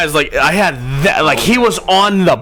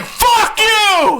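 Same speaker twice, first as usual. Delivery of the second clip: high-pitched retold curse; mock outburst. Man greeting Sean arriving home, mock angry yell. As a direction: imitate an exasperated man yelling, voice pitched way up.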